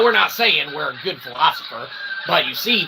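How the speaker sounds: ordinary delivery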